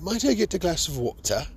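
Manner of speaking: a light imitation of a proper British accent. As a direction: lightly put on a prim British accent for the woman. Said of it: "this British woman".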